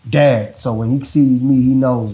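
ordinary talk, nothing marked

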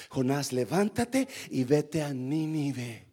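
low, slow, quiet command; voice of God. Acted. God ordering Jonah to Nineveh, low measured command. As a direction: send him off solemnly, softly and slowly. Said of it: "Dios le dijo a Jonás".

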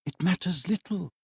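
said softly. A narrator's reading. Belle softly answering Scrooge, quiet and soft. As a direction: let it fall gently, almost under the breath. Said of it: "she said softly".

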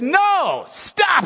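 loud, high sharp command; Jesus shouting stop. Retold or imitated. Preacher voicing Jesus, a loud high stop. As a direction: cry out to halt them. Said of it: "But he said".

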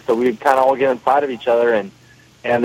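normal talking voice

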